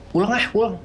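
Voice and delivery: high, anxious plea to go home; nervous pleading. Retold or imitated. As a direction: beg to leave right now. Friend wants to go home.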